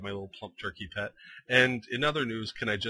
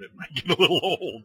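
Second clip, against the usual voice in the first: laughing; 4 semitones higher, 6 dB louder, faster than usual. Real, not acted. Joking about repeated deaths.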